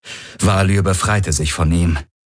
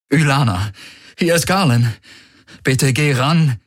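higher, pleading fake sorrow; feigned grief; 6 semitones higher than usual. Valur calling Ylana, faking grief. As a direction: put on distress. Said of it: "allen Kummer, den er heucheln konnte".

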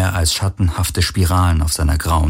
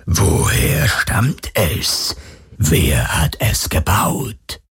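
phantom voice; much slower than usual. Acted. The phantom questions Galen about the device, whispered. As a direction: probe with eerie curiosity. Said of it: "fragte das Phantom".

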